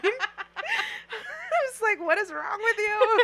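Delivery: high, laughing self-scolding. Real, not acted. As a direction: laugh through the words. Laughing about crying over clothes.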